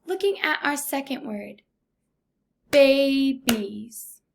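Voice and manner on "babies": "babies" stretched into two beats, louder; syllable by syllable. Shown as a demonstration. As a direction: sound out "babies" as bay, beez. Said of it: "This word has two syllables".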